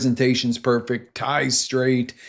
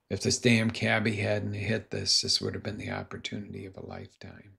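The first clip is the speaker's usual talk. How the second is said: softer, hung-head lament; lightly voiced despair, fading away; dejected mutter; 4 semitones lower, 8 dB quieter than usual. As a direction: mumble it in defeat. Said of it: "Gary hangs his head and he's like".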